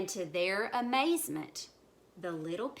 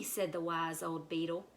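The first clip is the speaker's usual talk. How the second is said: plain reading voice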